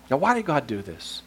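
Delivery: ordinary talk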